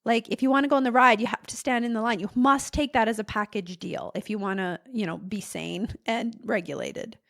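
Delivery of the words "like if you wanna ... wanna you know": normal talking voice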